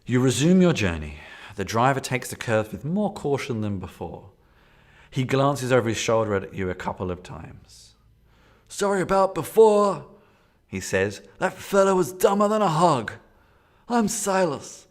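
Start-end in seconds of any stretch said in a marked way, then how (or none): 0.0-8.0 loud, dramatic gamebook read; story narration. Acted. 8.7-10.0 high, loud New England driver voice; a rural New England driver apologizing; New England accent. Acted. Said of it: "It's a New England accent". 11.4-14.9 high, loud New England driver voice; a rural New England driver apologizing; New England accent. Acted. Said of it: "It's a New England accent".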